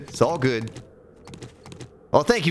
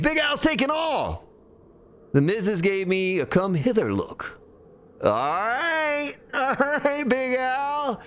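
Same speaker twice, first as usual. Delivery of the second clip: high put-on showman voice, held through; put-on Grand Spartan voice; 13 semitones higher, slower than usual. Streamer mimicking the Grand Spartan to chat, high put-on voice. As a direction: hype it up like the Grand Spartan's radio patter. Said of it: "talking like this".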